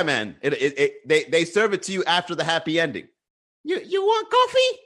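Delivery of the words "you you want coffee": very high put-on voice; squeaky mock server offering coffee; parlor worker impression. Massage parlor worker offering a customer coffee, in a high put-on voice.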